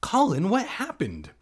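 high, loud alarmed question; concerned aunt. A narrator's reading. Aunt asking Colin what happened, high and concerned. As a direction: ask in a high, anxious voice. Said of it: "Aunt Marta asked softly".

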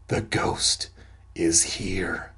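hushed, halting whisper; mild stammering fear. A narrator's reading. Roxanne warning that the ghost is here, hushed stammer. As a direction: barely get it out, pausing midway. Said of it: "she stammered".

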